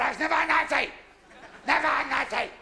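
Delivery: a hammed-up indignant old granddad; indignant denial. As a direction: protest it hotly. Granddad denies louder.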